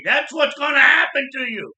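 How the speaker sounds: put-on loud, high angry shout at a kid; retold yell. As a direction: bark it at the boy like an angry uncle. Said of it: "he's yelling at me".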